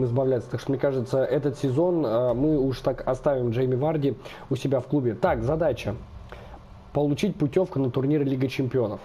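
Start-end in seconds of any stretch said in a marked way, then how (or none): none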